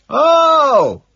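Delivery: high, loud cry; a long screamed exclamation; screaming. A narrator's reading. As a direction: yell it at full volume. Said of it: "I scream".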